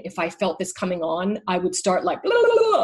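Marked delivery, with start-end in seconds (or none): 2.2-2.8 high, loud nonstop babble; babbling fast chatter. Retold or imitated. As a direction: babble away in a much higher voice to distract yourself. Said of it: "I would just start talking like crazy".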